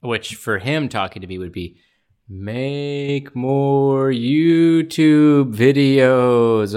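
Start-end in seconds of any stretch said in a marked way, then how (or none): 2.3-6.7 very slow ghostly drawl; spooky sloth voice. Retold or imitated. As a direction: stretch every word like a spooky sloth. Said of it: "it's got a spooky kind of tone".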